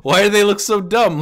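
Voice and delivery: high, loud disbelief; loudly mock their looks; incredulous. Real, not acted. Mocking the soldier figures.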